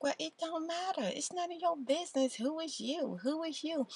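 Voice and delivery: high, sassy brush-off; defensive attitude. Retold or imitated. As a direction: put the nosy stranger in her place. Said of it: "she's like".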